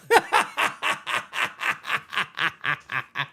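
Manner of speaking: low, rhythmic run of laughter; long cackle. Real, not acted. Streamer laughs at his nickname joke, long cackle. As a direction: laugh on and on at your own pun.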